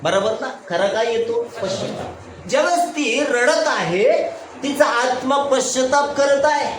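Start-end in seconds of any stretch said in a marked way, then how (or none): 1.6-2.5 whispered answer. Acted. Answers his own question in a whisper.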